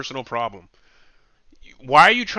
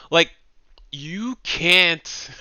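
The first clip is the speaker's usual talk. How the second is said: ordinary delivery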